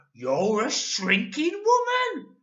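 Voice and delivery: put-on loud, slow, solemn old man; mock-serious Mister Twit. A narrator's reading. Husband faking worry to scare wife, slow and loud. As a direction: pretend grave concern. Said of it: "said Mister Twit".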